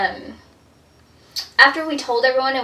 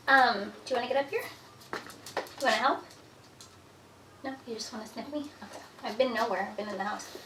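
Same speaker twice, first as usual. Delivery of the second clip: soft pet talk; 9 dB quieter than usual. Real, not acted. Talking softly to the cat.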